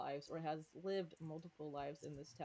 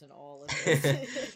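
laughing admission; 2 semitones lower, 15 dB louder, slower than usual. Real, not acted. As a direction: laugh while explaining.

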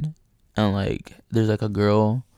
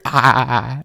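loud imitated groaning wail; agonized wail; 7 dB louder than usual. Storyteller re-enacts cry he heard in bathroom, agonized. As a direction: mimic a strained, drawn-out cry.

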